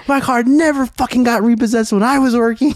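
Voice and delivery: very high-pitched retold outrage; pissed off. Husband replaying his complaint to his wife about the repossessed car, pissed off. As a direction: protest indignantly, voice shooting way up. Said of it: "I was so pissed off".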